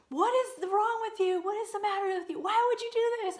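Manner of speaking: high, loud scolding in a husband's voice; imitated screaming. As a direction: yell it high like an upset husband. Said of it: "he's screaming at me".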